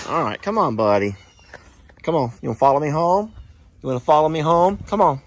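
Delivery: sweet-talking an animal to follow him; coaxing the bull. Real, not acted.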